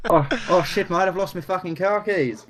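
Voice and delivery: high, hammed-up Aussie accent; mock Australian accent. Shown as a demonstration. Showing the co-hosts their best Australian accent. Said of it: "the best Australian accent I can do".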